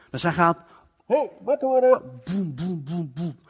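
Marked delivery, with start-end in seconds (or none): 1.1-2.2 high calling voice to wake someone. Acted. Friend at the door at night, calling high to wake him. 2.2-3.4 knocking sounds. Acted. Imitating knocking on door.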